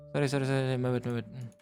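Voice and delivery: ordinary talk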